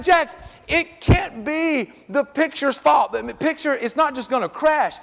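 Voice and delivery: retold exasperated dad. Dad answers his son's silly excuse, retold exasperation. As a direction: reason slowly with a child, mock exasperated.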